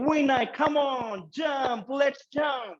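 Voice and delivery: drawn-out, high shout in a put-on American accent; mock US accent. Tandem instructor urging a scared jumper, mock US accent. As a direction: call out high and long, imitating an American. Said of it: "in that U S accent".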